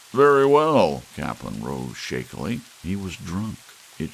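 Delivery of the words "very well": mock offended. Drunk guest takes offence.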